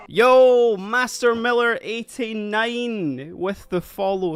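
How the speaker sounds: normal talking voice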